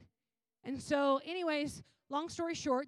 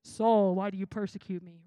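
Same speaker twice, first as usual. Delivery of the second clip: low, booming first word; voice from heaven; 5 semitones lower, 10 dB louder than usual. Retold or imitated. Heavenly voice confronting Saul on the road, solemn. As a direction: lower the voice and thunder the name, imitating heaven. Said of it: "a voice from heaven said".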